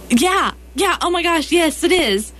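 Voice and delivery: high, insistent starstruck gushing; imitated excitement. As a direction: act out a fan who is totally convinced. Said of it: "she was really excited".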